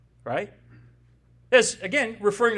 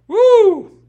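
a joyful whoop, much louder and higher; excited woo; 13 semitones higher, 16 dB louder than usual. Real, not acted. Preacher thrilled by Jesus standing up boldly, loud whoop.

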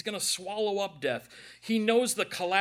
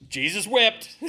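raised, emphatic higher voice; loud recital; 4 semitones higher, 10 dB louder, slower than usual. Real, not acted. Preacher answering his own Sunday school question, loud and high. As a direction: belt out the memorized verse. Prompted verse, said aloud then softly.

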